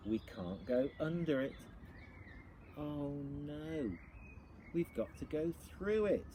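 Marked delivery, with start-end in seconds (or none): none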